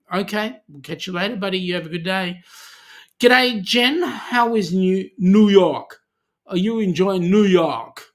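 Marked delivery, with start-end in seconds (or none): 3.2-8.0 clumsy attempt at an American accent; mock American accent greeting Jen; terrible Aussie-to-American accent. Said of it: "terrible accent, I know".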